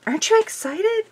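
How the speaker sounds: high, loud eager question; urging him to share the thrill; excited pleading. Retold or imitated. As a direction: squeal it at the husband. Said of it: "I'm like".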